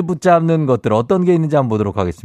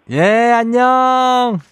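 cutesy goodbye; 8 semitones higher, 3 dB louder, much slower than usual. Pretend.

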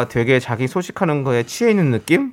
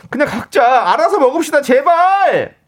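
exasperated shout; 10 semitones higher than usual. Acted. Listener's exasperated plea read out.

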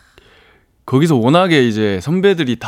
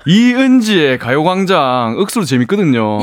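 Daegu dialect. Shown as a demonstration. Guest promoting the radio show to listeners, Daegu dialect. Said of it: "대구 사투리로 한번 인사 한번 해주시면".

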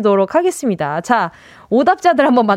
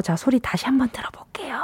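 whispering; 2 semitones higher, 8 dB quieter than usual. Acted. Hushed cue to listen.

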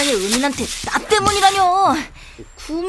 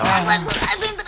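fast, panting, half laughing; out of breath; much faster than usual. Acted. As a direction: gasp from the heavy load. Servant carrying master, exhausted.

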